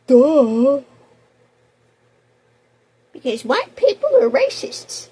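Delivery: sarcastic high imitation of a smug voter; mocking voter. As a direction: parody the other side's answer. Mocking Democrat voters' reasoning.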